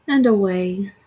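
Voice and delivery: usual speaking voice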